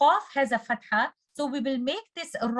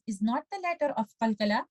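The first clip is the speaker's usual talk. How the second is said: usual speaking voice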